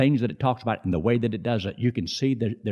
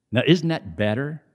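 ordinary talk

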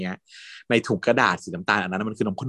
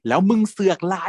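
angry challenge; 10 semitones higher, 3 dB louder than usual. Retold or imitated. Friend confronting rival.

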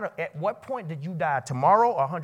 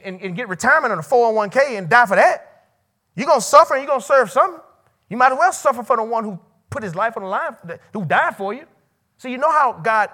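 loud, high impassioned preaching; full-force preaching; 6 semitones higher, 9 dB louder than usual. Real, not acted. Preacher pleading with students, loud preaching. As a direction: preach it hard to the room.

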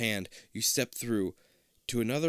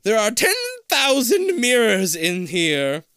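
high, slow, eerie disembodied voice; hollow echoing voice; 10 semitones higher, 10 dB louder, slower than usual. Acted. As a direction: echo it out like a ghost in the walls. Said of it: "a hollow voice".

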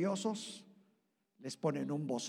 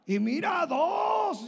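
tempting shout; 12 semitones higher, 10 dB louder, much slower than usual. Pretend.

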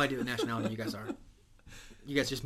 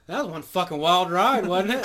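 louder, slow captain farewell; 5 semitones higher, 10 dB louder than usual. Retold or imitated. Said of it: "I'm like".

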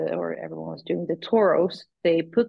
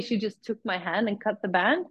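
ordinary delivery

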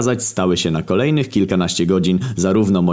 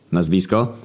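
plain reading voice

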